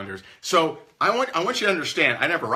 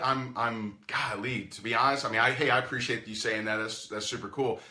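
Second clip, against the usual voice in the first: ordinary delivery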